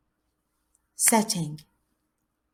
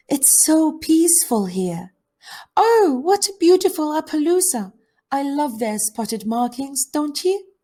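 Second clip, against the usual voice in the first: higher-voiced girl taken with a horse; admiring camper girl; 9 semitones higher than usual. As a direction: admire it in a lifted girlish voice.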